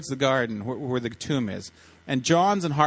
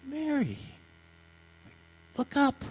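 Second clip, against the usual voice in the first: soft, very high, gentle calling; tender call; 9 semitones higher, 5 dB quieter than usual. Acted. Jesus to weeping Mary, tender soft call. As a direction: call her name softly, high and slow.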